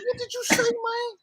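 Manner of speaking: very high mock-angry challenge; imitated hot temper. Friend demanding cab driver repeat himself, mock angry high voice. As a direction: pitch it way up without shouting. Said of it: "O gets hot".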